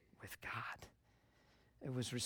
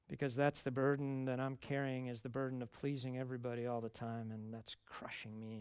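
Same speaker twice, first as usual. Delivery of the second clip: usual speaking voice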